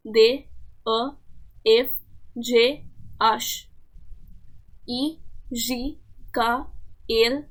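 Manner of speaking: each letter spelled out slowly, the French way; French alphabet. Shown as a demonstration. Teacher to Hindi-speaking students, reading the French alphabet slowly. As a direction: read out the French letter names one by one, carefully. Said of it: "pronounce कैसे करते हैं".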